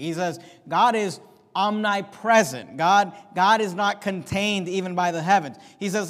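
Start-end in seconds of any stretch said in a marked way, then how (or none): none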